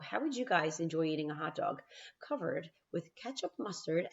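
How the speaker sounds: ordinary delivery, nothing marked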